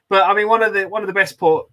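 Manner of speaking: ordinary talk, nothing marked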